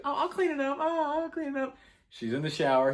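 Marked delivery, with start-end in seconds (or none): 0.0-1.8 very high, sing-song creepy girlfriend imitation; high mock Nikki voice. Girlfriend covering up her mess, very high mock voice. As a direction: squeak it out sweetly as the creepy girlfriend. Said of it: "And she's like".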